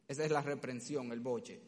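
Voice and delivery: ordinary delivery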